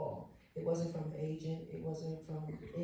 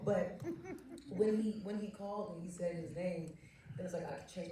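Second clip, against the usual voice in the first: usual speaking voice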